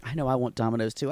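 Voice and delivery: usual speaking voice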